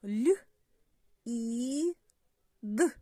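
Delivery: Л, І, Д as three separate sounds, long pauses between; sounded out. Shown as a demonstration. Teacher spelling a word by sounds for children, sounded out. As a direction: sound out Л... І... Д one by one. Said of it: "Давайте промовимо слово по звукам".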